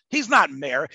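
ordinary talk, nothing marked

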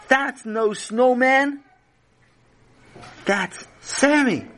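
slow, high dawning; realizing slowly; slow realization. Acted. Mother realizing.

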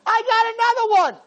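bragging guy. Retold or imitated. Guy bragging to his friends, high and fast. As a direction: crow about the conquest.

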